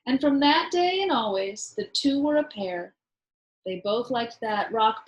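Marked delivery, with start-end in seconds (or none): none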